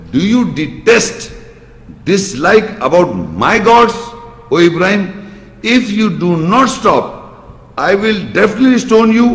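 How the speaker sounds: emphatic imitation of an angered father; angry father. Father threatening his son over his gods, retold angrily. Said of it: "when he gets so angry".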